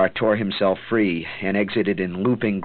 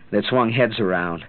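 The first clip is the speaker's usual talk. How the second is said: ordinary delivery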